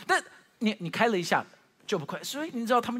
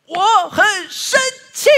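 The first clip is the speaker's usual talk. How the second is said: much higher and much louder, slow and crying; crying angry child; 14 semitones higher, 15 dB louder, slower than usual. Retold or imitated. Little daughter on the stairs complains through tears, a loud, high crying wail. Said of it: "她就这样说".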